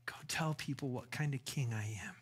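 hushed whisper of the king's command. Acted. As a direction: breathe it as a secret charge. Jesus voiced, then repeated.

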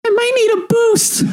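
very high small voice; character voice. Acted. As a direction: squeak it up sheepishly. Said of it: "Brimley looks around and says".

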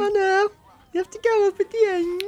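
very high, exaggerated Brummie lilt; singsong high-pitched Birmingham impression; Birmingham accent. Shown as a demonstration. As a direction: ham up a Brummie accent, going up at the end. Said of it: "it's a bit like Birmingham".

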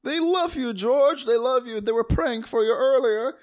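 high, loud, hammed-up gushing; overjoyed uncle. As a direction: gush the good news to him. Continuing the uncle's excited speech.

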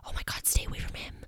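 quick, hushed whisper; fast whisper. Retold or imitated. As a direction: whisper it fast like a scared shopper. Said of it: "look at that person like".

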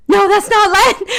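high, loud mock indignation; inner protest. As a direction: squeal it as a thought in your head. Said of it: "but I'm like".

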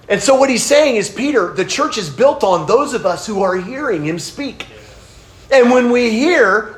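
high, loud, driving sermon voice, swelling to near shouts; preaching at full force. Acted. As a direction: preach it out to the congregation, climbing in pitch. Preaching the binding passage.

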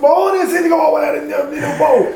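high-pitched mock amazement; excited reaction. Man hears old tracks on cassette, excited reaction.

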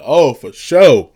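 loud, drawn-out retold reply; speaking up. Man told to speak up answers the lady, loudly. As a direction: belt it out slowly, falling away at the end. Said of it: "You need to speak up".

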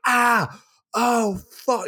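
high hammed-up yelp of pain; mock pained yelp. Rambo hurting his arm in the fall, high yelp. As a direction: yelp like you just hit every branch.

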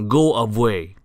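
loud, cross dismissal; grumpy snap. A narrator's reading. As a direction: send them off crossly. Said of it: "snapped Mister Grumpy".

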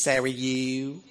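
slow, drawn-out Baton Rouge twang; Baton Rouge accent. Retold or imitated. Teasing a Baton Rouge church's pronunciation, mock local accent. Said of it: "the proper pronunciation is".

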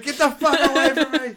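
high, loud battle yell. Acted. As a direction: shout it swinging the sword. Character pulling sword, yelling.

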